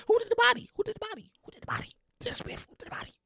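high murmur fading to whisper; mock funeral whispering, fading away; hushed mourner. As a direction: murmur it, then trail into a bare whisper.

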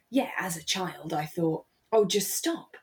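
ordinary talk

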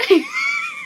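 high shrieking laugh. Real, not acted. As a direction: squeal with laughter. Cackling after a joke.

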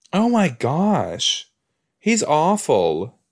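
real horrified groaning at the screen; horrified disgust. Real, not acted. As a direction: recoil at something gross, stretching every word. Horrified reaction to brutal scene.

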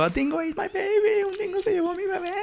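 the mother's desperate movie cry; distraught mother. Retold or imitated. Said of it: "sale la mujer diciendo". (madre desesperada, voz mucho más aguda)

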